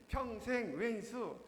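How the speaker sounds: slow, loud, stretched 평생 왼수; loud shout. Retold or imitated. Grandma answers her husband again, slow loud shout. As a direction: drag it out and shout it. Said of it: "큰 소리로".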